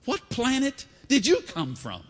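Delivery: incredulous, high and slow; drawn-out disbelief at a clueless stranger; Cleophas. Acted. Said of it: "Maybe I'll be Cleophas for a moment".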